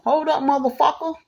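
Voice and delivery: high-pitched retold telling-off; retold cussing out. Woman retelling how she cussed out an arguing man, retold telling-off. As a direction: cut him off with a cuss, pitched high. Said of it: "I cussed his ass out".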